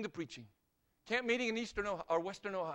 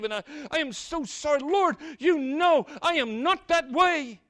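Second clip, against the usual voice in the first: pleading confession; 4 semitones higher, 6 dB louder than usual. Acted. Sinner at the altar begging God's forgiveness, pleading and building louder. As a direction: plead with God, swelling to a near shout.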